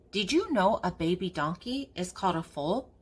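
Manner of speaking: plain reading voice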